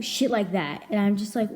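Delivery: normal talking voice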